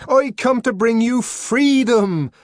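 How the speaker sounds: high, loud, slow declaration; proclamation. A narrator's reading. Savage proclaiming freedom to the twins, loud. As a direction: proclaim liberation.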